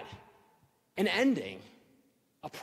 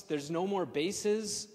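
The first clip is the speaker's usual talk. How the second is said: ordinary talk, nothing marked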